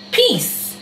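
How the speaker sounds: a lightly voiced breezy "peace" sign-off; mock goodbye. Shown as a demonstration. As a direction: toss off "peace" like a careless goodbye. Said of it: "This is swearing in the U K".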